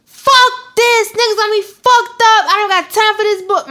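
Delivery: high, loud mock outburst; hammed-up furious rant at mama; angry rant. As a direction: vent like you're fed up on the phone. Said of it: "on the phone with my mama and I'm like".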